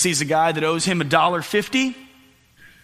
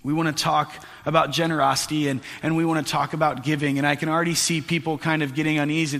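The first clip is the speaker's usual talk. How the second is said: ordinary delivery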